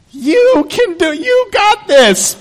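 very high, slow cartoon puppet voice; Pinocchio speaker voice. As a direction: squeak it out like a wooden puppet coach. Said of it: "and he goes".

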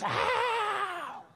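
long, very high mock "ow"; imitated howl of pain. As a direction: howl like dad hitting a hidden book. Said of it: "and he goes".

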